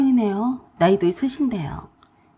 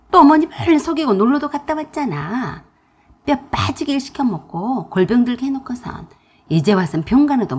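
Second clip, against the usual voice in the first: louder scolding tirade; pouring out anger; 4 dB louder than usual. Acted. Pregnant narrator angrily scolds sister-in-law. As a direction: let it all out at her. Angry phone quarrel.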